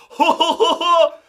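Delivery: loud, high mock belly laugh; hammed-up idiot laughter; fake dopey guffaw. Capping the joke he denies making, fake dopey guffaw.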